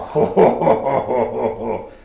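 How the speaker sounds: big booming "ho ho ho" belly laugh; loud, rolling monster guffaw; ogre laugh. Acted. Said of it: "You laughing at fat ass?".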